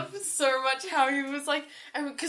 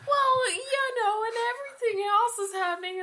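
Pinocchio; 10 semitones higher than usual. Retold or imitated. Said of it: "Pinocchio, yeah".

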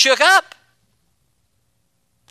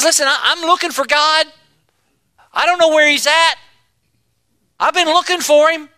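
higher, pleading Job voice; searching Job; 6 semitones higher than usual. Acted. Preacher voicing Job searching for God, pleading. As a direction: lift the voice, lost and searching.

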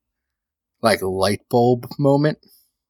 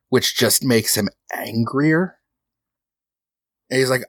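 usual speaking voice

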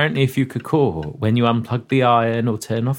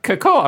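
parrot call; 10 semitones higher than usual. Shown as a demonstration. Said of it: "I have to screech".

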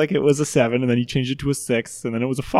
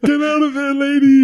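shouted call; 13 semitones higher, 7 dB louder than usual. Acted. Player calls out in character.

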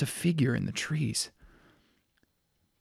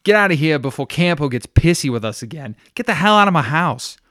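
shouting; 4 semitones higher, 11 dB louder than usual. Acted. Nadia ordering guests out.

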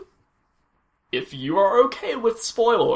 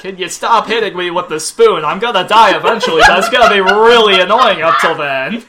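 annoyed; 8 dB louder than usual. Retold or imitated. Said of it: "acting Jack's annoyed quote".

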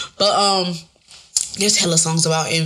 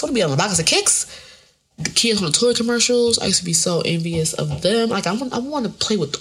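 ordinary delivery

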